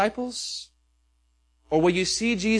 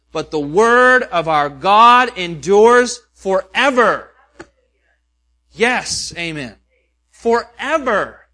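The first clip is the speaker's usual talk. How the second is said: louder, slow and ringing, building to forever; full-force preaching; 4 semitones higher, 6 dB louder than usual. Acted. Pastor proclaiming Isaiah forty to his church, loud and rising. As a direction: proclaim it like the word itself is unshakable.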